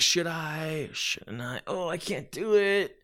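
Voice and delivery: whispered doubt, then a high, slow, drawn-out give-up; mock hesitant second. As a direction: ham up the second's indecision. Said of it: "it's too much".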